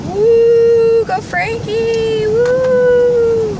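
high, loud cheer, slow on Go Frankie; excited whooping cheer. Real, not acted. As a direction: whoop for the cat like a fan. Cheering the cat's surgery.

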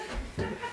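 a short high laugh, breaking out; high giggle bursting out; burst of laughter. Real, not acted. Laughing at friend on camera.

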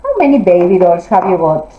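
normal talking voice

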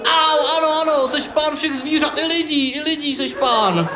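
high squealing surrender; whiny boy in pain. Acted. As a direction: yelp the yes out fast.

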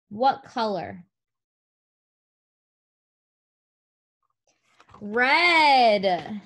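slow, clear picture-book voice; sing-song prompts for little kids; reading to a toddler. Acted. Teacher reading to young children.

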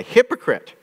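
preacher voicing Jesus' rebuke, pitched high; rebuking cry. Shown as a demonstration. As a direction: fling the word out as a rebuke.

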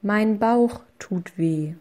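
ordinary talk

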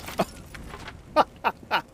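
gleeful laugh. Real, not acted. Laughs after landmine blast.

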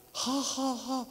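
high, put-on ha-ha-ha; imitated involuntary laughter. Holy Ghost laughing through him, high mimed laugh. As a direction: mime laughter that takes over. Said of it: "He's laughing 'cause I don't feel like laughing".

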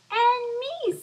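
high, loud little flea; a tiny flea chiming in. Acted. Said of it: "said the flea".

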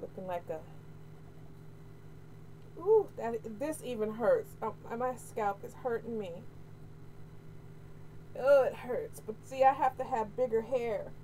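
ordinary talk, nothing marked

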